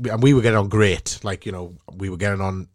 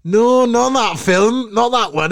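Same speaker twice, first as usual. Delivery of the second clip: much higher, louder, slow desperate insistence; frantic backtrack; 13 semitones higher, 5 dB louder, slower than usual. Retold or imitated. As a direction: scramble to fix the blunder, hammed-up. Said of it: "And I was just like".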